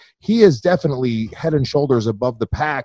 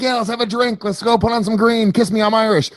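higher, bouncy mock Irish reveller; cheery Irish partygoer; 8 semitones higher than usual. Mocking the Irish to the guest, higher cheery partygoer voice. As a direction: play a jolly drinker calling for a pint, pitched up. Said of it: "the Irish is like".

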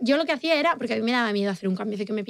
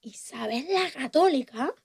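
slow, drawn-out mock recitation; acted exam recital; 3 dB quieter, slower than usual. Girl acting her way through a history exam, slow theatrical recital. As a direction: stretch the words out theatrically, rising at the end. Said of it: "Entonces yo empezaba".

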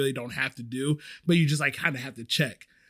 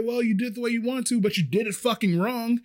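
higher, fast, heated retort; yelling back; 7 semitones higher than usual. Retold or imitated. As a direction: fire it back quickly mid-argument. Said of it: "yelling back and forth in the kitchen".

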